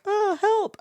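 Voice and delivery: high little plea for help; mock panic. Awkward shop worker floundering in a foreign language, mock panic. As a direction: put on a helpless high squeal.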